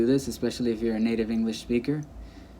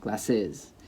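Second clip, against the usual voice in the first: GLASSES as gla-SIZ, weight on the end; stress on last syllable; 2 semitones lower, 4 dB quieter than usual. Shown as a demonstration. Teacher repeating the wrong form, glasses stressed on SIZ. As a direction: land on the second syllable of glasses. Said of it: "as opposed to".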